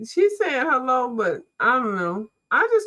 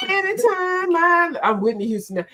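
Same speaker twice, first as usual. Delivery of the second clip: normal talking voice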